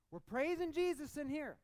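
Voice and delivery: loud, high preacher's cry; bold proclamation. Real, not acted. Preacher to congregation about praising inside church, loud proclamation. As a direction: belt it out from the pulpit.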